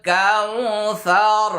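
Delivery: slow, drawn-out Quranic recitation; teacher models the verse for students, slow recitation. Shown as a demonstration. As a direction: recite each word slowly and clearly. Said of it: "এভাবে আয়নাটা ধরে ধরে practice করুন".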